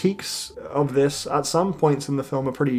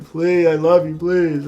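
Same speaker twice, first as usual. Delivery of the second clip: louder, lightly acted begging; mock pleading; 3 semitones higher, 6 dB louder than usual. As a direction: beg her like a hammed-up lovesick man. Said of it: "pleading at the feet of Dakota Johnson".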